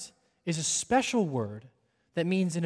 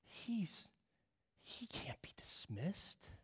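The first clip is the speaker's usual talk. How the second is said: whispered, drawing the congregation close; soft whispered opening; hushed whisper; 15 dB quieter than usual. Real, not acted. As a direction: lean in and whisper it.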